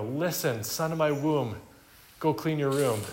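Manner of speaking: overdone motherly. Retold or imitated. Preacher spoofing a mother using lofty words for chores. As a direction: overdo the tender plea for a chore. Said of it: "this could quickly be overdone".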